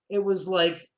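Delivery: ordinary delivery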